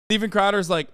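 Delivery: ordinary talk, nothing marked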